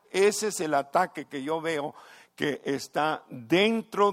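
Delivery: loud, climbing pulpit proclamation; full-force preaching. Acted. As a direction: proclaim it to the whole church. (predicación a todo pulmón)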